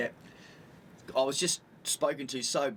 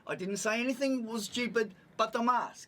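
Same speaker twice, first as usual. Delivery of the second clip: raised-pitch retold protest; 7 semitones higher than usual. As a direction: insist on your innocence, pitch up. Retold self-defence in mask quarrel.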